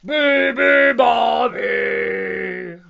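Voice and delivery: very loud lion's roar of a chant; roaring animal chant. A narrator's reading. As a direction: bellow each syllable long. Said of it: "roared the lion".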